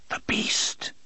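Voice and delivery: trembling, breathless whisper of dread; fearful whisper. A narrator's reading. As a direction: whisper it as if afraid to name it.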